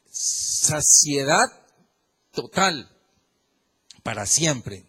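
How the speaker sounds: saciedad stretched very slowly, then total, para siempre set apart by pauses; drawn-out emphasis. Acted. Preacher promising lasting fulfilment to the church, slow weighty stress. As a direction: let each word land alone. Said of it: "preacher dwells on key words".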